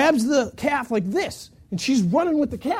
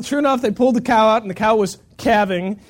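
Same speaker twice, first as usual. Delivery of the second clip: ordinary talk, nothing marked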